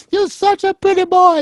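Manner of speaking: put-on very high, cooing old Italian grandmother; grandma voice. Grandmother cooing over her little grandson, very high voice. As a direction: coo it like a doting grandma pinching a cheek. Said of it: "She'd go".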